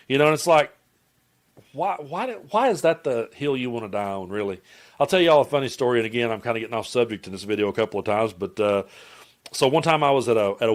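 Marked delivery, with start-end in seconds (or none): none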